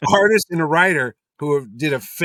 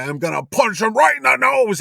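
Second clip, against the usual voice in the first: acted-out shout, pitch raised sharply; retold angry threat; 8 semitones higher, 6 dB louder than usual. Writer threatening his old collaborator, acted angry shout. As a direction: imitate a furious threat, loud and high.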